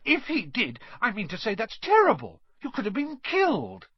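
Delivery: put-on high-pitched, appalled girl; heated, emphatic scolding; indignant outburst. A narrator's reading. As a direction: protest in shocked outrage. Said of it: "Hermione exploded".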